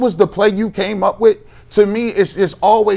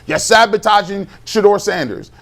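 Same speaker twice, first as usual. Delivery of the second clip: ordinary delivery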